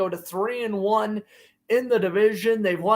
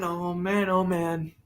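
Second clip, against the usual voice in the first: usual speaking voice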